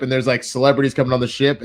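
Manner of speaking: ordinary delivery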